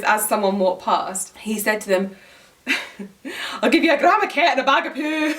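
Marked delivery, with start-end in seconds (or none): 3.6-5.3 higher, laughing Scottish drunk imitation; hammed-up Scottish accent, barely holding back giggles; Scottish drunk man. Imitating a drunk Scottish street hawker selling to passers-by, Scottish accent. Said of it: "a drunk man on the street".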